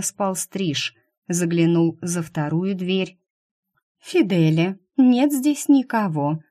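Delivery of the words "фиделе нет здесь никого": high pouty woman's voice; pouting. A narrator's reading. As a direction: pout the words. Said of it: "надула губки Нинья".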